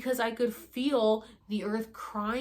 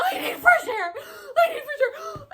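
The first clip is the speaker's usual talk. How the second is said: half-laughing mock wail; 7 dB louder than usual. Retold panicked plea.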